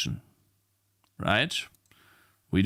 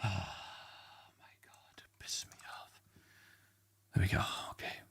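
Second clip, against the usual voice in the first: defeated whisper; 4 semitones lower, 15 dB quieter than usual. Real, not acted. Streamer whispering to himself after the download stalls. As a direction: whisper it as if out of energy.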